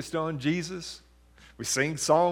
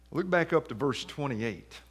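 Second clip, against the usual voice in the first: ordinary talk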